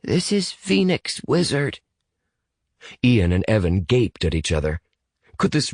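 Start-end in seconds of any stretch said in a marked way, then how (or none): 0.0-1.8 put-on high-pitched child's voice; little boy. Boy identifies himself on the phone, high child voice. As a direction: lift it high for a young boy.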